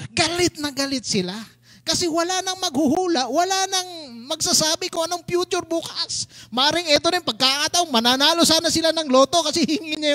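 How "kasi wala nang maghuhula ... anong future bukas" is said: ordinary talk, nothing marked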